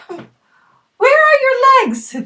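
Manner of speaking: high, slow, wide-eyed question; high girlish voice. Acted. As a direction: ask it in a small high girl's voice. Girl asks the legless man.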